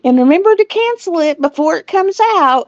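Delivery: high-pitched quoting voice; imitated bank clerk. Bank clerk telling customer to wait, imitated in high voice. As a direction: recite it high, as someone else's instructions. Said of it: "She was like".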